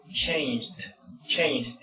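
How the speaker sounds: "changed" slowly, as one syllable; "changed" said carefully for learners; pronunciation demo. Shown as a demonstration. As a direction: model "changed" twice with a crisp "ch". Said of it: "la pronunciación de una H es como una T".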